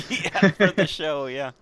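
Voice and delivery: high and loud, laughing through the words. Real, not acted. Host laughing at co-host's recommendation joke, loud laughing reply. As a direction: burst out laughing as you answer.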